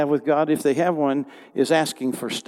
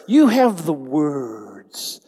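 you have the words called out slow and loud; drawn out, ringing you have the words; louder, slow proclamation; 5 dB louder, much slower than usual. Shown as a demonstration. Pastor leads the congregation, slow and loud. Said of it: "Everybody say the words".

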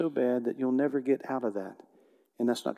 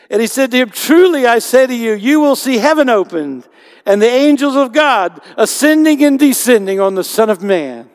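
preaching at full force; 9 semitones higher, 13 dB louder than usual. Acted. Preacher crying out Jesus' promise to the congregation, booming. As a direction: ring it out over the whole church.

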